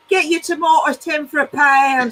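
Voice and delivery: slow market-stall sales cry; drawn-out street-vendor patter; market trader impression. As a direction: call it out like a fruit and veg seller. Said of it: "every time he speaks, it sounds like".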